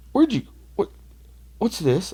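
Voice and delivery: high startled questioning; dad stunned at the table of bottles; sudden high-pitched what's this; dad's shocked question. Retold or imitated. Dad seeing seized whiskey.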